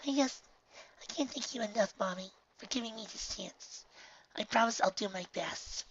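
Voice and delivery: put-on high, soft, grateful young voice; emotional filly voice. A narrator's reading. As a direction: thank her mother near tears. Filly thanks tearfully.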